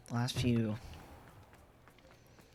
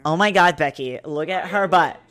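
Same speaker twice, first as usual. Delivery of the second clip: put-on loud Valley Girl gush; 3 semitones higher, 7 dB louder than usual. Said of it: "this is a Valley Girl voice".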